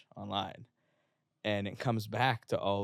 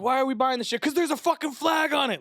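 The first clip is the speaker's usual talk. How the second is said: much higher, loud hammed-up ad shout; mock patriotic ad; 8 dB louder than usual. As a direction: yell it like a cheesy flag-waving commercial. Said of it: "the Latch On to America type of shit where they're like".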